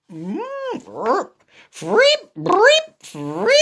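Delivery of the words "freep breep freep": high squeaky toots; squeaky trumpet noises. Acted. Elephant practising trumpet, high squeaky toots.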